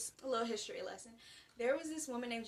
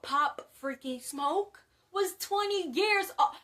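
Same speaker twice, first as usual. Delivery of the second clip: loud, high, incredulous outburst; astonished near-shout; shocked exclamation; 6 semitones higher, 9 dB louder, slower than usual. Real, not acted. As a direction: blurt it out in disbelief, building louder. Shocked revelation about rapper's age.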